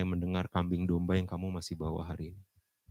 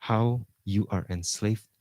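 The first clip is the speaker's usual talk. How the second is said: ordinary talk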